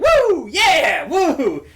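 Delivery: high, loud gleeful whoops; whooping war cry. Retold or imitated. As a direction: charge in yelling like it's a game. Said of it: "running through the store like".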